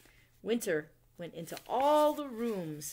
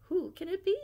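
puzzled owl; 11 semitones higher, 5 dB louder, faster than usual. Acted. Owl hears knocking again.

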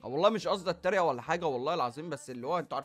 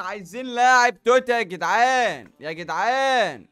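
loud, slow begging call; drawn-out plea; 4 semitones higher, 11 dB louder, slower than usual. Real, not acted. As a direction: stretch out the plea to the guys.

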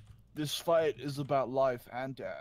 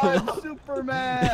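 excited shout; 11 semitones higher, 9 dB louder, much slower than usual. Pretend. Flying in game, excited shout.

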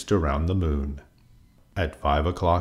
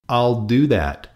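screeching; 6 semitones higher, 4 dB louder, slower than usual. A narrator's reading. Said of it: "screeched the grouchy ladybug".

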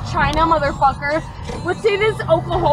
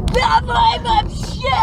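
high, drawn-out mock shout; retold Russian shout; 7 semitones higher, slower than usual. Rider retelling her answer to girls studying Russian, high shout. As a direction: holler it out in Russian, slowly.

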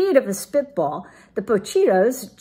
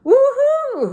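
very high, loud whooping hoot; 8 dB louder than usual. A narrator's reading. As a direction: whoop like a thrilled crowd. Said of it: "hooted the crowd".

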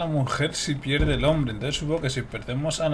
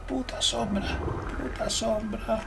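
much higher, drawn-out exasperated cry; high, slow mock-furious wail; mock rage; 10 semitones higher, 4 dB quieter, slower than usual. Real, not acted. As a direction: wail the curse at the shadow. Cursing the shadow again.

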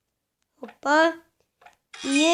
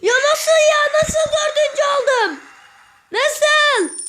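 much higher, incredulous "nasıl"; disbelieving outcry; 9 semitones higher than usual. Real, not acted. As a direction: cry out in disbelief at losing the place. Shocked at dropping to fourth.

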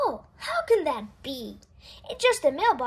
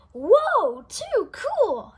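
excited exclaim; 5 semitones higher, 5 dB louder, much slower than usual. Acted. Pete spots Grumpy Toad.

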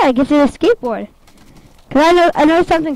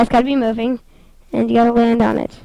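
ordinary delivery

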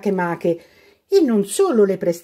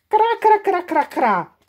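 crows cawing; 12 semitones higher, 3 dB louder than usual. Acted. Crows answering.

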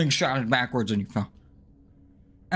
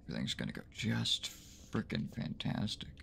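sarcastic mutter; 7 semitones lower, 15 dB quieter than usual. Real, not acted. Sarcastic hope before next part.

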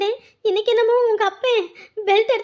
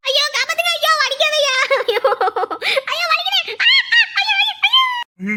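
screaming in pain; 5 semitones higher than usual. Acted. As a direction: shriek as if being beaten. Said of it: "இப்படி கத்திட்டு இருக்கு". (அடி வாங்கி அலறும் பயந்த குரல்)

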